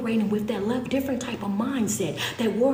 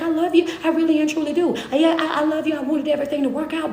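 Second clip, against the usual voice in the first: higher and louder, pleading farewell; parting words; 6 semitones higher, 4 dB louder, faster than usual. Acted. Woman leaving her partner, high pleading farewell. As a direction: say goodbye as you walk out the door.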